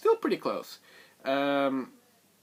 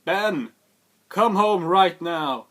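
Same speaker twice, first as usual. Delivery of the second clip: slow, loud call, higher and louder; mock shout; 6 semitones higher, 8 dB louder, slower than usual. Han calling his son home, loud slow shout. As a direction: bellow his son's name to come home. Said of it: "and is like".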